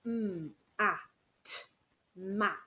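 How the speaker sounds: mm, at, then mat said whole; segmented then blended. Shown as a demonstration. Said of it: "For example".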